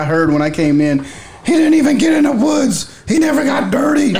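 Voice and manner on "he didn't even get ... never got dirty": high shouted imitation, pitch way up; mock shout. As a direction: yell it in a high mocking voice. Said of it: "the first thing I heard".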